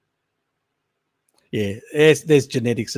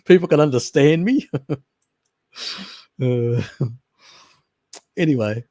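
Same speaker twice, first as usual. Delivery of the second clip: ordinary talk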